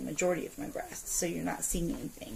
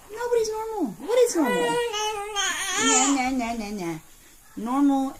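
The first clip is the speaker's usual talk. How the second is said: much higher, louder exasperated outburst; heated rant; 10 semitones higher, 8 dB louder than usual. Real, not acted. Mom ranting to viewers that nobody is normal, exasperated. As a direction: vent it full force.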